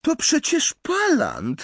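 put-on high-pitched, incredulous girl's voice; squeaky scornful outburst; Pansy exclaiming. A narrator's reading. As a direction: cry out in disbelief, very high and drawn out. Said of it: "zawołała Pansy".

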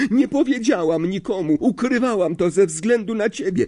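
high, agitated outburst; high-pitched accusing cry; distraught protest. A narrator's reading. Hermione protests in the quarrel.

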